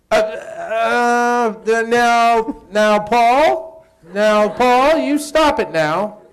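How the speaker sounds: hammed-up old woman scolding; imitating older actress. Kathy finally delivering her line, high loud old-lady mock. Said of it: "she goes".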